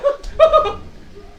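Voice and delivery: real high cackle of amusement; high laugh. Real, not acted. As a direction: burst into a high-pitched laugh. Preacher laughing at own joke.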